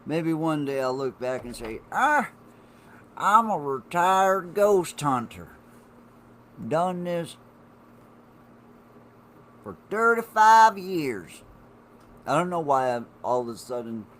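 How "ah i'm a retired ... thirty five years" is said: much higher and louder, slow Southern drawl; old Southern man. Pretend. Looking back on his life as an old Southern ghost hunter, in a loud, slow, put-on Southern voice. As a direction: ham up a grizzled Southern old-timer. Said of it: "turned into a Southern man".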